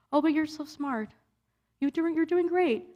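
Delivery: lightly mocking cheerful praise; mock reassurance. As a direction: pat her on the head with it. Mimicking people's dismissive praise.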